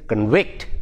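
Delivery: conVICT, rising onto the second syllable; light first syllable, land on VICT in convict; second-syllable stress. Shown as a demonstration. Teacher showing convict as a verb, second-syllable stress. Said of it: "goes to the second syllable".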